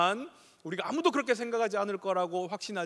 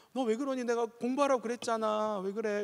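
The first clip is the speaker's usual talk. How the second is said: higher, mildly scolding Seoul mother; gentle Seoul mom scolding; 6 semitones higher than usual. Retold or imitated. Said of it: "이렇게".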